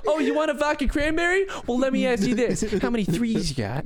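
high, showy magician patter; card magician patter. Pretend. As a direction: hype up the card trick. Playing the magician bartender.

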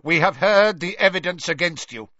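loud stern. A narrator's reading. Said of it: "speaking more loudly".

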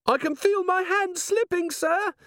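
very high, strained squeal; panicked dwarf. A narrator's reading. Dwarf dangling from the candle line, high panicked voice. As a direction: squeak it out, barely holding on.

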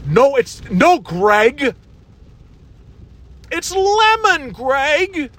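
high, slow, drawn-out scolding; second Tom Wambsgans try. Retold or imitated. Podcaster retries Tom scolding Greg, very high and slow. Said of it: "that wasn't so good".

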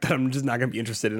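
ordinary talk, nothing marked

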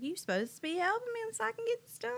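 high, fed-up call to her husband; exasperated call. Retold or imitated. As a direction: demand an answer, annoyed. Scolding husband.

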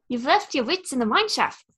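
lightly voiced New Zealand Korg; Korg accent. Friend guessing game, Korg in a relaxed New Zealand accent.

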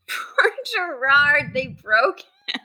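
slow words through laughter; laughing. Real, not acted. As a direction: laugh while you say it. Hosts laughing at the story.

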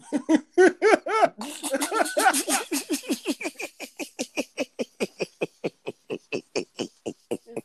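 high, helpless cackling; real long laugh. Real, not acted. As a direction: crack up and keep laughing. Cracking up at own insult.

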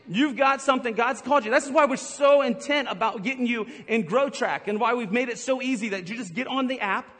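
ordinary delivery

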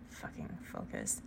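harsh whisper telling herself to focus; exasperated whisper to self; whispered self-scolding. Real, not acted. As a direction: hiss it at yourself in frustration. Scolding herself mid-story.

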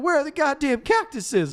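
high, loud exasperated shout; hammed-up high yell; mock frustrated yell. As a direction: vent at the empty desert. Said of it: "it's like".

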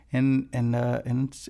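ordinary talk

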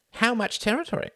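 put-on gruff older man. Sam pressing Reginald on the plan. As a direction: ask it bluntly as old Sam.

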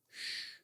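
a soft library shush. Acted. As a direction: hush the room. Library hushing you.

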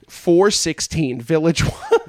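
slow address broken by laughter; cracking up, drawn out; laughing, slow. Real, not acted. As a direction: spell out the address through laughing. Laughing at his own trivia.

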